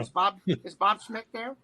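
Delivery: put-on talk-show-host voice; halting celebrity impersonation on the phone; Jay Leno impression. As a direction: stammer the question in the impersonated voice. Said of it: "Hey, it's Jay Leno".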